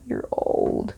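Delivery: whispered aside. Real, not acted. Repeats jab as aside.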